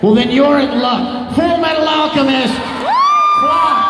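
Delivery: loud, high prize reveal; excited announcer. Pretend. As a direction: shout it like a game show host. Hyping a prize like an ad.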